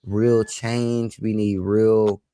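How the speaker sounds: ordinary talk, nothing marked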